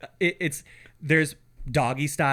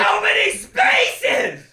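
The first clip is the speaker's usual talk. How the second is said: high, slow, loud sarcastic yell; mock shout; 5 dB louder, much slower than usual. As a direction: bellow it slowly at the rude campers. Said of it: "I'd have been like".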